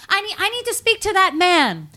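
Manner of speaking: very high, desperate movie-heroine plea; mock freaking out. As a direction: plead urgently way up high. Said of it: "freaking out".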